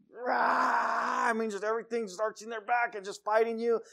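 high, cry-like tantrum yell; mock toddler rage. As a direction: imitate a toddler throwing a fit.

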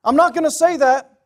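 high, loud, insistent sermon voice; emphatic, impassioned pulpit cry; full-force preaching. Real, not acted.

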